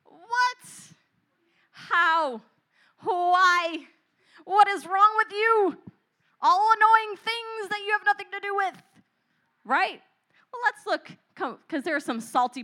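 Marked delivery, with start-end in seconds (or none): 0.0-8.8 high, loud, disbelieving What; mock outrage at a friend's choice; shrill astonished squawk at the friend; shocked outrage. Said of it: "And you're like".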